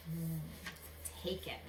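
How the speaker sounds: usual speaking voice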